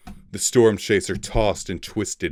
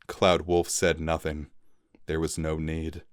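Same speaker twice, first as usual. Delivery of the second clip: ordinary delivery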